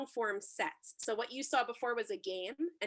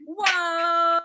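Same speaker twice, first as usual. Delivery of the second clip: loud high whoa; excited whoa; 6 semitones higher, 10 dB louder than usual. Real, not acted. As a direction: cheer it out big. Host reacts to scene.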